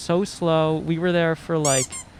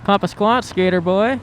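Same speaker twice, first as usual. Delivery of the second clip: chummy cop voice; 5 semitones higher, 5 dB louder than usual. Pretend. Joins cop roleplay.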